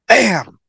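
loud, high bang; imitated explosion. Pipe bomb going off, loud imitated bang. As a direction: punch out the blast in one burst.

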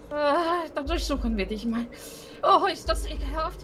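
loud, grossed-out outburst; loud disgust. Real, not acted. Player searching a gross corpse, loud disgust. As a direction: shout your revulsion at the mess.

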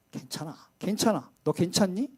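soft, hushed reassurance; gentle comforting. Retold or imitated. God soothing the despairing prophet, soft and tender. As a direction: coax a worn-out friend back.

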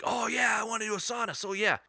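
high, thrilled burst of yes; light up like a kid offered a treat; excited Nick. Retold or imitated. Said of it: "he lit right up like a switchboard".

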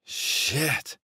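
long, high slurred curse; drunken slur. A narrator's reading. Younger brother spilling his scotch, drunkenly slurred. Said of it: "he slurred".

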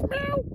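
high, soft mock meow; a tiny high kitten meow; playful cat meow. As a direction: mew like a little cat. Cat noise after sign-off.

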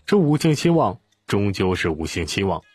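ordinary delivery, nothing marked

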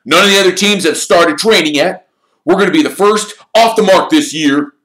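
high, loud, hearty team captain; rally the team with gusto. Acted. Wood continues.

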